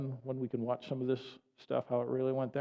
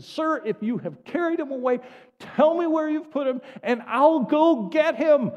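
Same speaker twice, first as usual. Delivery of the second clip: loud, high, urgent acted plea; impassioned plea; 12 semitones higher, 10 dB louder than usual. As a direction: plead at the top of your voice, stretching the last words. Preacher acting Mary's frantic plea.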